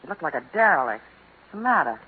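ordinary talk, nothing marked

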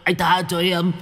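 high, stuttering, panicky begging; stammering plea. A narrator's reading. The ghost begging Violet to stop, panicked stammer. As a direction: beg for mercy, tripping over words. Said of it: "ú ớ".

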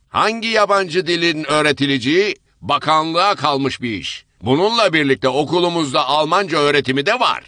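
bespectacled man cutting off the tangent; formal official man. Acted. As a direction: steer the meeting back politely. Bespectacled man chairs meeting.

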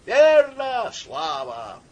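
high drawn-out shout of approval; crowd cheering. A narrator's reading. Crowd answering the orator, cheering. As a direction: call out from the crowd, voice falling away.

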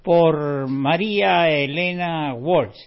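loud, slow back-announce over fading music; name the song and singer like a host coming back on air; radio announcer back-announce. Real, not acted. Radio host back-announces the song to listeners, loud and slow.

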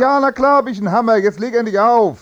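high, loud and fast, fed up; exasperated shout. Acted. Husband on the phone snaps at his friend amid a quarrel, loud exasperated outburst. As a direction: snap back fast and loud. Argument with wife while on phone.

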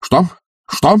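high, sharp threatening question; menacing. A narrator's reading. Master lifting his head at rude servant, menacing. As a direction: snap it as a threat. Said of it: "грозно заговорил Обломов".

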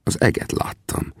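plain reading voice

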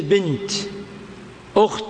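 "بنت، أخت" ending on an audible t; pause on ta. Shown as a demonstration. Teacher showing words stopped on ta, final t sounded. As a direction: stop on a crisp t in "بنت، أخت". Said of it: "وقفت أيضًا بالتاء".

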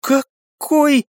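very high puzzled question; baffled, high-pitched query; bewildered. A narrator's reading. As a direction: ask it haltingly, pitch way up. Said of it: "с недоумением спросила подруга".

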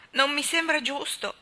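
rebellious. A narrator's reading. Grieving mother rebelling against her loss, loud protest. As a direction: push back against the injustice. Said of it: "disse Anna ribellandosi".

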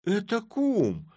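high, slow surprised cry; startled cry. A narrator's reading. Godfather startled to find a friend in the sack. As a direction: shout it out startled. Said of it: "вскрикнул".

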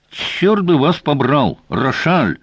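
high-pitched, exasperated outburst; angry cry. A narrator's reading. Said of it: "крикнул он".

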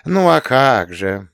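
slow, drawn-out feigned surprise; aunt acting surprised for the little girl; mock astonishment. A narrator's reading. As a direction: play at being amazed. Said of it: "тётя Настя изобразила изумление".

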